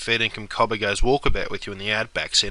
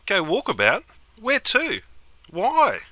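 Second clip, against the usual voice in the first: surprised; 10 semitones higher, slower than usual. Acted. Mate baffled by walkabout plan.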